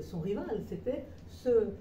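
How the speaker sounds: usual speaking voice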